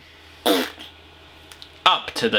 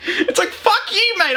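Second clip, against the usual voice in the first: much higher, laughing through a yobbo shout; laughing mock shout. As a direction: crack up mid-sneer. Repeat, laughing.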